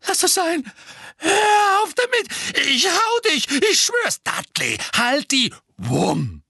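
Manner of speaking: high, loud frightened shouting; panicked threats. A narrator's reading. Dudley, terrified, threatens Harry before hitting him. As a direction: lash out in blind panic.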